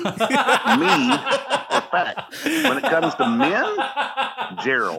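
high laughing joke; laughing punchline. Real, not acted. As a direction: crack the joke while laughing. Joking punchline through laughter.

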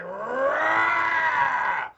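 long, loud angry roar; mad roar. Acted. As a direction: roar it out like an enraged wrestler. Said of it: "then he gets mad".

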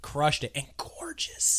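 slow whisper of admiration; whispered praise. Real, not acted. Co-host praising the actress, whispering. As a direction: whisper it slowly, in awe.

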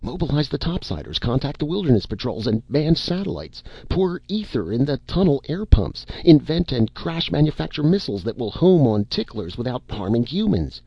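panicked, begging them to act now; frantic pleading. A narrator's reading.

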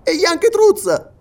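slow, very high, loud query; astonished question. Acted. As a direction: lift it high in disbelief. Listener asks in growing surprise.

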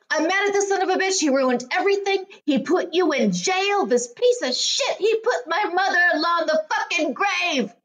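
fast, high ranting mock fury; imitating Joe's rage. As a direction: spit it out like an enraged man at dinner. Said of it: "And he's like".

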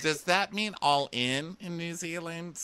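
imitated contestant. Voicing contestant's high-pitched question.

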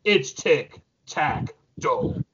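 ordinary talk

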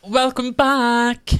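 high, loud, slow host greeting; welcome back. Acted. Host welcoming listeners back, in a high, slow presenter voice. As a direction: open the show big and slow, like a presenter. Podcast host reopening the episode.

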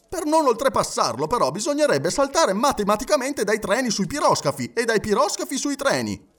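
high, animated protest; agitated objector. Acted. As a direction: argue back excitedly. (protesta animata)

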